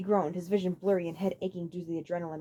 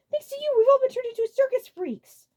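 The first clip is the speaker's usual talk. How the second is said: high, loud accusation; furious outburst; angry; 8 dB louder than usual. A narrator's reading. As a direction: blame him bitterly. Lemmy blaming Abrak.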